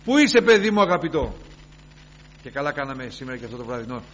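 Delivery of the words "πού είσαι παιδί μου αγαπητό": put-on loud, high call for a beloved child; the Lord calling. The Lord searching for his beloved child, loud call. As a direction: call out searching for the one you love. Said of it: "λέγει ο Κύριος".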